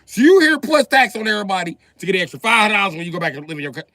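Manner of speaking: loud, fast, high rant. Acted. Griping to the crowd about greedy people, loud and fast. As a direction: rattle it off like a fired-up rant.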